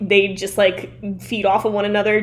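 ordinary delivery

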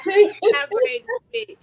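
high, loud burst of laughter. Real, not acted. Laughs at the bar story.

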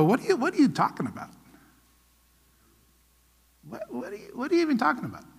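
lightly imitating a baffled churchgoer, quick and dismissive; mocking churchgoers. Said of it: "so many churchgoers go".

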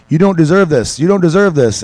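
mock a Russian fighter taunting his opponent; Russian accent. Fighter on top of his opponent, taunting in a Russian accent. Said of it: "he's got this, like, Russian accent".

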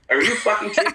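doctor can't believe his ears; mock outraged doctor; incredulous doctor. Doctor's incredulous outburst, retold.

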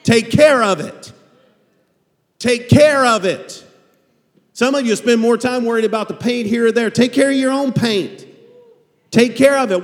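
high, loud pulpit exhortation; full-voiced sermon push; fiery preaching. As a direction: preach it hard at the congregation.

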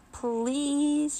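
high, drawn-out plea; pleading. A narrator's reading. Minxie begs for a turn, pleading. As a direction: beg with a long, high please. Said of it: "she pleaded".

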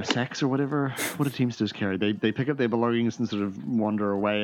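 ordinary talk, nothing marked

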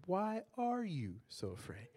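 slow, drawn-out, with "are" leaned on; Why "are" you so afraid, said slowly with the weight on are; stress on are. Shown as a demonstration. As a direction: press on "are" and slow the whole line down. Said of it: "You can emphasize different parts of it".

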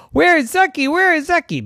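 put-on high, loud frantic calling for the missing boy.

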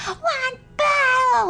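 high, drawn-out mock scream; imitated screaming. Podcaster mocking actors who just scream their lines, imitated screaming. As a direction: wail it out in a held, high screech. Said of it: "they're literally just screaming".

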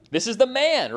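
excited emphasis. Real, not acted. Preacher hyping Boaz to the congregation, loud and high. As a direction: sell him as the one.

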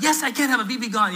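high-pitched acted comeback; retold squabbling tone; defiant retort. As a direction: argue back, insisting. His retort in retold quarrel.

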